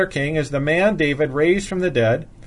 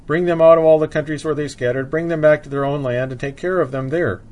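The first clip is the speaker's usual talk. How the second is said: ordinary talk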